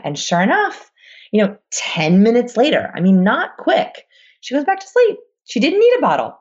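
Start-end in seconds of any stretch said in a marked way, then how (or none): none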